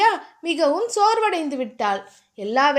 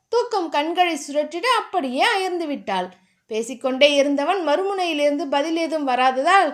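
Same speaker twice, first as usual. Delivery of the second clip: usual speaking voice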